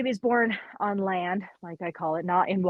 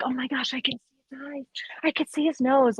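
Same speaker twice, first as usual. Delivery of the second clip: retold excitement; 6 semitones higher than usual. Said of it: "he's just narrating this whole experience".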